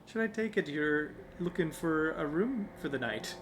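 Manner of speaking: a failed, high Irish accent attempt, breaking into a laugh; attempted Irish tilt. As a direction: try a light Irish lilt and give up laughing. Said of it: "Her voice has a slight Irish tilt".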